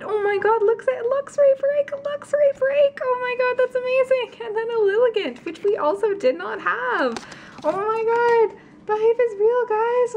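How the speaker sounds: sustained high-pitched fangirl squeal; overjoyed amazement. Real, not acted. Opener showing viewers a surprise break card, overjoyed amazement. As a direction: gush very high, words stretched in disbelief.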